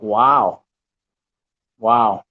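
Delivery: stunned. Retold or imitated. Stunned reaction retold.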